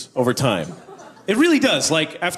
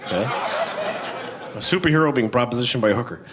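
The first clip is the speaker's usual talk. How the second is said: ordinary talk, nothing marked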